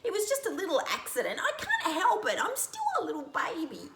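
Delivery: put-on high squeaky baby bird pleading. Baby bird pleads with Lizzie, high and fast.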